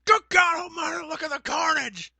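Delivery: very high, loud commentator's outcry; hammed-up horrified ringside call; Jim Ross. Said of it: "go into a Jim Ross impression".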